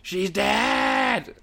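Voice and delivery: high, loud mock scream of panic; hammed-up shriek, stretched on the last word; imitated screaming. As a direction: scream it out like a hysterical partner. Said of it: "my missus screaming".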